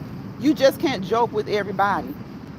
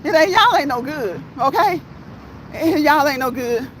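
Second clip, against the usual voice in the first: normal talking voice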